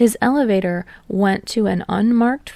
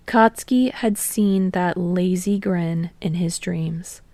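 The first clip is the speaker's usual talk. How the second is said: ordinary delivery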